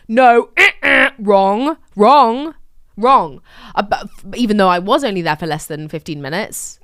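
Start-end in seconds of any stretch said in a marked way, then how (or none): 0.1-3.4 loud, punchy "wrong", repeated like a quiz host; mock buzzer "wrong". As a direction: slam each "wrong" like a game show buzzer. Mocking game-show buzzer of wrongness.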